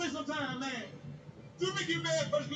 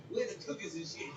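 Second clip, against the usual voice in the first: put-on toddler voice; 7 semitones lower, 6 dB quieter than usual. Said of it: "I heard the two-year-old say".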